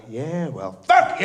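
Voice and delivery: hammed-up fuck you; mock defiance. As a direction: spit it back at her. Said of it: "kind of going".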